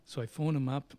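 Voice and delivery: ordinary delivery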